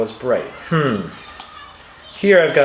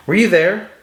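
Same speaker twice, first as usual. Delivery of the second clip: "Were you there?" asked higher, peaking on you; questioning intonation; 6 semitones higher, 4 dB louder than usual. Shown as a demonstration. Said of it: "this is something that she's asking".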